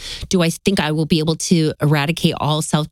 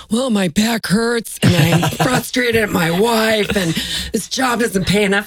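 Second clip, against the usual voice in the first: slow, droning list of gripes; a hint of a weary cashier unloading; lightly voiced complaining clerk; 3 semitones higher than usual. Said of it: "Looking at the name tag".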